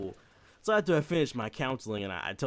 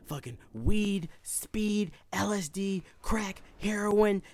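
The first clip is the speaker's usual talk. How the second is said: high, slow listing of drugs; boastful kid; 10 semitones higher, 3 dB louder, much slower than usual. Retold or imitated. Josh bragging to Corey about drugs, high and slow. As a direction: savor each drug name like a proud kid. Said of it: "He said".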